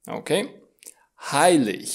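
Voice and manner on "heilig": "Heilig" with the final g as a hissed ich-sound; g as soft H. Shown as a demonstration. Teacher repeats the IG rule, "Heilig" ending in a soft h sound. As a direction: say "Heilig" as "hai-lich". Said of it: "G-ul se mai pronunță ca în odihnă".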